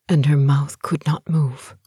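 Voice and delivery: plain reading voice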